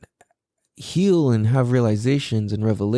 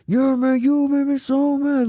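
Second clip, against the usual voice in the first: mock whiny adult. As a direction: whine it, blaming the kid. Mocking parents who blame kids.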